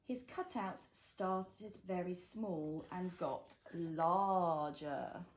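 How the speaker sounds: slow, gentle picture book reading; reading to a toddler. A narrator's reading. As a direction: read it slowly for a little one. Reads picture book to child.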